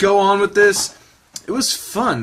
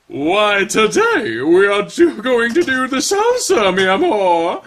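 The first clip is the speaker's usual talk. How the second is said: hammed-up Spanish-tinged teacher, louder; grand, theatrical greeting in a put-on accent; a showy instructor's put-on accent; deep-voiced dance teacher; 5 semitones higher, 4 dB louder, slower than usual. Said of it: "give these weird fucking voices".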